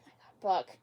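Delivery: whispered disbelief at the screen; a shocked whisper aimed at Buck; exasperated whisper. Real, not acted. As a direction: breathe it out, unvoiced and dismayed.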